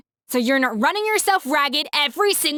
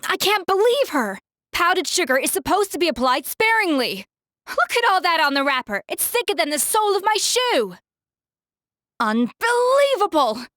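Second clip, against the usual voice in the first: higher, indignant chef's tirade; exasperated outburst; 6 semitones higher than usual. Acted. Escoffier fuming at the sugar-caked wrapper, indignant outburst. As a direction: huff with disbelief at the sloppy work. Outraged at too much powdered sugar.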